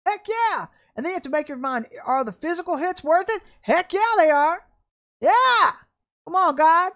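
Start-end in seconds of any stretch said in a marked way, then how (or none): 0.1-0.7 high fired-up heck yeah; excited. Real, not acted. Preacher fires up. 3.6-6.9 loud, high preacher's shout; shouting praise to God; fervent shout. Real, not acted.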